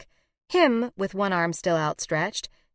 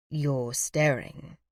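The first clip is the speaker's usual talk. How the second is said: deadpan, drawn out; dry; 3 semitones lower, much slower than usual. A narrator's reading. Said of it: "he says dryly".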